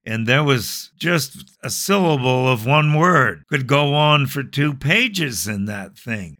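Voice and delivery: usual speaking voice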